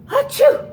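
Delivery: high, loud mock sneeze; a huge cartoon achoo; gigantic sneeze. Snowman sneezing in the story, loud high mock sneeze. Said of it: "The snowman sneezed a gigantic".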